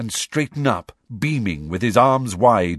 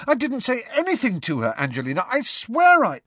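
high, pleading protest; horrified protest. A narrator's reading. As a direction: beg her to believe him. Harry protests in dismay.

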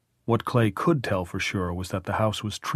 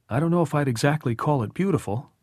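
higher, quicker character voice; wry homeowner, pitched up; Tom, wry and easygoing; 6 semitones higher, faster than usual. Acted. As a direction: lift the pitch and talk briskly. Narrator voices Tom in dialogue.

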